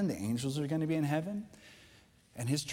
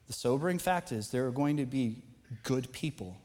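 ordinary talk

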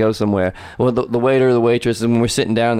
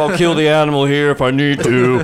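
macho overcompensating steak order; deepest voice; 3 semitones higher than usual. Retold or imitated. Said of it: "get the deepest voice I can".

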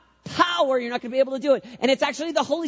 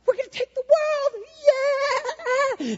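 hyped up; much slower than usual. Retold or imitated. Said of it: "we're like".

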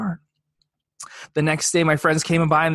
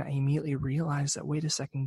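ordinary delivery

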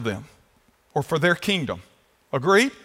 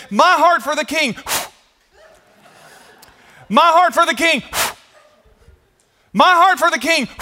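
high, loud crowd yell; crowd screaming; 10 semitones higher, 5 dB louder than usual. Retold or imitated. Pastor voicing the crowd screaming as the feather falls, loud and high. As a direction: shout it like a desperate crowd. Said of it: "see them saying".

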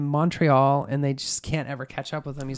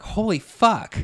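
higher, louder mock astonishment; stunned reaction; 7 semitones higher, 6 dB louder, slower than usual. Police hearing the killing described, stunned. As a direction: blurt it in shock.

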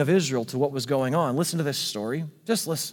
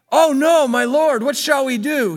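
high, loud panicked outcry of a servant; panicked cry; 9 semitones higher, 7 dB louder than usual. Acted. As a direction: cry out in alarm, pitched up and loud. Panicked servant sees surrounding army.

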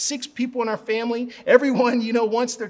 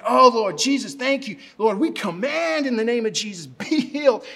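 fervent, raised-voice healing prayer; all-out commanding prayer; 3 semitones higher, 4 dB louder than usual. Retold or imitated. Praying again for the lady, fervent and commanding. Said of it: "I'm just going for, I'm all these words".

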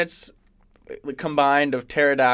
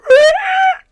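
high screaming roar; wild roar; 12 dB louder than usual. Retold or imitated. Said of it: "just being like".

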